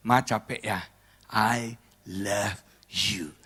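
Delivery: slow, low, very soft husband; tender soothing. Acted. Husband hugging his tired wife from behind, soft and loving. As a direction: soothe her softly, stretching the last words.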